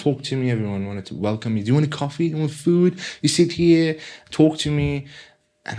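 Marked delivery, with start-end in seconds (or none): 1.6-5.1 lightly voiced hosts, higher-pitched offers; eager welcomers. Church people welcoming a newcomer, lightly imitated eagerness. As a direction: lift the pitch as if fussing over a guest.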